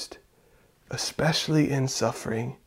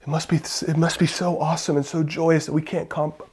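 ordinary talk

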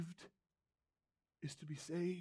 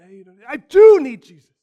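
emphatic retort; 6 semitones higher, 30 dB louder than usual. Acted. Preacher answers wealth's voice emphatically.

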